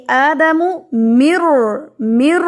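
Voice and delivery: مر with a full heavy ra, said slowly. Shown as a demonstration. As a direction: thicken the ra in مر. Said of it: "یہ جو را ہے یہاں سے ہیوی ہوتا ہے".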